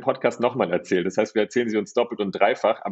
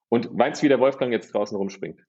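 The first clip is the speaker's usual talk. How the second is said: ordinary talk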